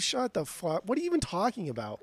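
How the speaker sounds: ordinary talk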